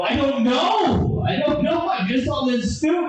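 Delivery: high, shouted panic; mock panicked shout. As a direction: yell it high, helpless and freaked out. Panicked reply in story.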